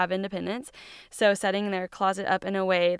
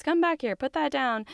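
higher, nagging parent commands; mock shouting parent; 6 semitones higher than usual. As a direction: play the fed-up parent barking orders. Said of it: "constantly be shouting out to my kids".

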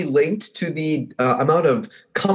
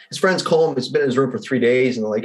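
ordinary delivery

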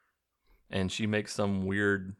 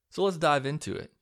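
cliche podcast intro; 7 semitones higher than usual. Acted. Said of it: "the most cliche way possible to start an episode".

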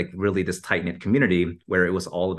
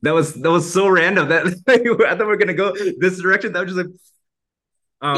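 laughing; 11 semitones higher, 6 dB louder than usual. Real, not acted. Laughing at random question.

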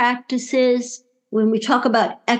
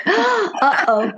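nervous laugh; 11 semitones higher than usual. Real, not acted. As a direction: gasp then a nervous giggle. Rapid fire questions announced.

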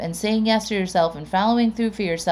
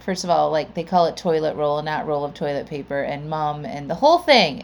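normal talking voice

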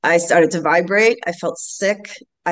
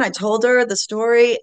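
ordinary delivery

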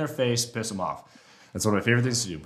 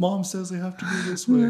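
much higher, laughing whine; whiny bull voice; 8 semitones higher than usual. Retold or imitated. Bull explaining he must follow the herd, laughing whine. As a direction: sulk like a kid tied to mom. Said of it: "And he was like".

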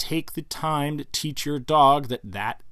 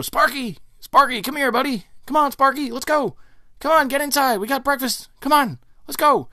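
much higher and louder, sing-song calling; pleading call to dog; 14 semitones higher, 6 dB louder than usual. Retold or imitated. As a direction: call the dog in, high and begging. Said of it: "you go".

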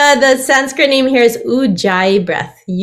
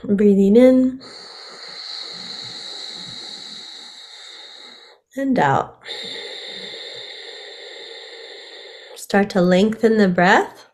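guided meditation; 7 dB quieter than usual. Acted. Guides a breathing exercise.

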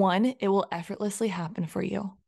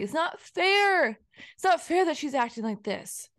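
put-on whiny inner child; 7 semitones higher than usual. The inner child complaining about another woman, high childish whine. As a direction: whine it out like a sulking kid. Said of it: "almost inner child is just like".

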